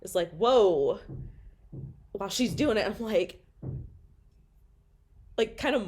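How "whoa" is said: startled whoa. Retold or imitated. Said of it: "and she jumps".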